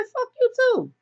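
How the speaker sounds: very high mock-angry squeal; retold cussing back. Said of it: "they cuss each other out".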